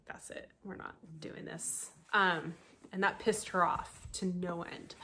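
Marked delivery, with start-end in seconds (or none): 0.1-1.9 hushed dismissal. Retold or imitated. Husband shutting down a fight.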